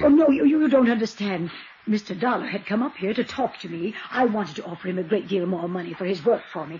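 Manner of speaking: fast, gabbling denial; rushed excuses. Acted. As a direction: talk fast to cover up.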